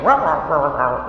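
a playful monkey noise in a lecture; monkey talk. Retold or imitated. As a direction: chatter rah like a monkey. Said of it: "making some monkey talk".

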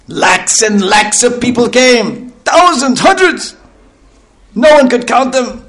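high, slow, awestruck build over the numbers; excited. Acted. Preacher marvelling to listeners at the vast crowd, rising excitement.

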